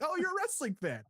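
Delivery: high-pitched mock discovery; retold feigned surprise. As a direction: act out a delighted "oh, you too" reaction. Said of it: "And then it's like".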